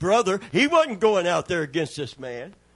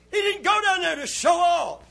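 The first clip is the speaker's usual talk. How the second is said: sudden preacher's shout; preaching shout; 13 semitones higher than usual. Real, not acted. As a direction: cry it out from the pulpit. Preacher's sudden high cry.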